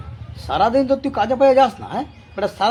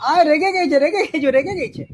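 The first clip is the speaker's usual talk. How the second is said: teasing taunt; 4 semitones higher than usual. Acted. Taunting the angered friend.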